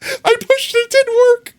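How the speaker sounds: squeaky high voice, breaking into laughter; can barely get the words out for laughing. Real, not acted. Ad button fails, cracks up.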